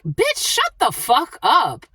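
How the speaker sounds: high, drawn-out telling-off; retold exasperated outburst. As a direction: act out a slow, exasperated put-down. Retells telling the woman off.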